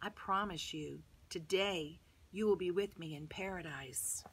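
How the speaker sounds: soft, low reassurance; gentle promise. A narrator's reading. Jesus answers the thief beside him, gently reassuring. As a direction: promise it quietly and calmly.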